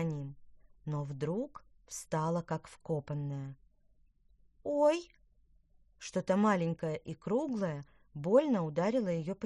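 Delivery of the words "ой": high, loud little "oy"; startled cry of pain. A narrator's reading. Girl struck on the cheek, startled yelp. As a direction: yelp as if suddenly hit. Girl hit by a flying pea.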